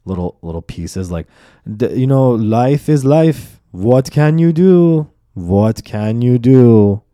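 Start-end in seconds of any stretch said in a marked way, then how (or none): 1.8-7.0 put-on louder, slow, mom's accented shrug of a saying; mom's accent, resigned. Mom consoling her son with a saying, accented and resigned. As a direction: lean into mom's resigned folk wisdom.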